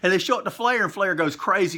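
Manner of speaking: ordinary delivery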